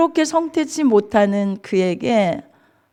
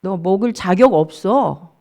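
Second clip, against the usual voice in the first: contemptuous taunting; 4 semitones lower than usual. Acted. Rival wife mocking the childless Hannah, contemptuous. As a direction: act out a rival belittling her, jab by jab. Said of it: "엄청 무시했어요".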